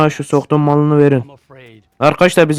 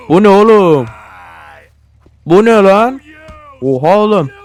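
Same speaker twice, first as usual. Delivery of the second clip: startled shout; 3 semitones higher, 3 dB louder than usual. Real, not acted. Shocked at something in game.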